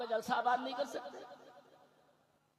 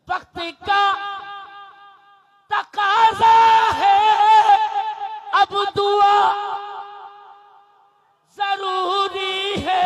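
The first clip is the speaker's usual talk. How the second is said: higher, much louder, near-shouted line; shouted verse; 7 semitones higher, 22 dB louder, much slower than usual. Shown as a demonstration. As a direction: call it out to rouse the crowd. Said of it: "سب بول دیں گے تب اگلا مصرع".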